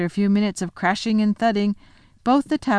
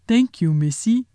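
giant thanks Lucy; 3 semitones higher, 4 dB louder than usual. Acted.

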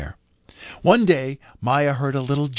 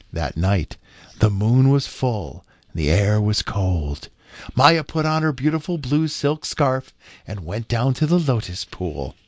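ordinary delivery

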